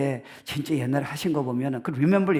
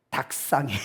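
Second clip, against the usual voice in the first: normal talking voice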